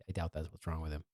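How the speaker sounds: usual speaking voice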